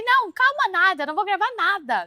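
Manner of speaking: very high-pitched, heated refusal; re-enacted angry outburst. Refusing to calm down for her sister, re-enacted anger. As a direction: hammer out an exasperated refusal. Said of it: "eu fiquei muito brava".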